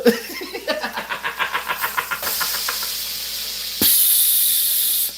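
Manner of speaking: long high airless laugh; wheezing laugh. Real, not acted. Laughing at his own dig, long and airless. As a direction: laugh until the voice drops out.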